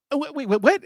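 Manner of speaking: high, stammering disbelief; retold flustered double take; confused. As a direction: repeat yourself in baffled high pitch.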